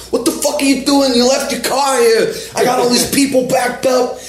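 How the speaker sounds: high mock yell of an angry attendant; furious yell. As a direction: blast it like an outraged Jersey pump attendant. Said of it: "this guy is just so fucking pissed".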